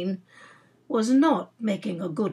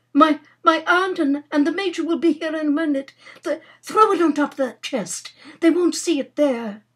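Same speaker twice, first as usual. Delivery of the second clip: stammering, high, loud panic; urgent, tumbling orders before they walk in; frantic; 8 semitones higher, 7 dB louder than usual. Acted. Nora warning that her aunt is coming, frantic. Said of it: "said Nora frantically".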